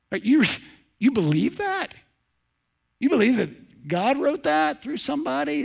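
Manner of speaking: high, incredulous scoffer; mocking disbelief. Preacher acts out a scoffer questioning belief in scripture, mocking.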